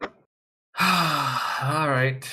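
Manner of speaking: long resigned sigh before speaking; weary sigh. Acted. Vash lifting his head off the desk, weary sigh. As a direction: heave a big reluctant sigh.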